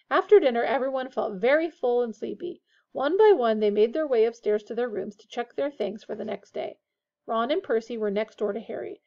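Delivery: plain reading voice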